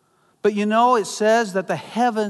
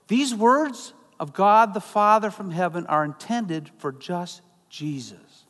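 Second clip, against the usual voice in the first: ordinary delivery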